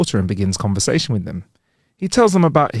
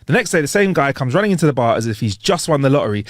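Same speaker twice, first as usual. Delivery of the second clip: ordinary talk, nothing marked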